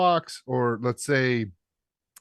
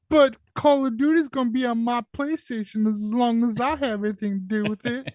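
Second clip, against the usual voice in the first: Southern Belle voice; 14 semitones higher than usual. Retold or imitated. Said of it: "Why did he have a Southern Belle voice".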